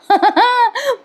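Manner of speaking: high burst of real laughter; a sudden high laugh. Real, not acted. Narrator laughs at scene.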